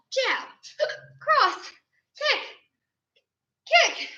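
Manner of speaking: calling moves with hiccups; hiccuping through the count. Real, not acted. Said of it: "hope I get rid of my hiccups".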